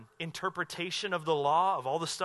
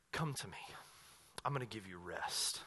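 much softer, lower, tender call; gentle invitation; 9 semitones lower, 13 dB quieter than usual. Retold or imitated. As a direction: offer rest softly to weary people. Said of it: "Jesus comes on the scene and says".

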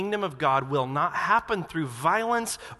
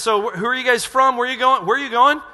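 loud, higher, pushy questions; louder, higher suspicious innkeeper; mock demanding innkeeper; 6 semitones higher, 8 dB louder, faster than usual. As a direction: grill the strangers loudly. Innkeepers questioning Jesus' group.

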